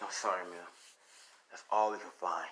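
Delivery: low, hushed acted apology; apologetic cop. Cop to crying mother, apologetic. Cop repeats bad news gently.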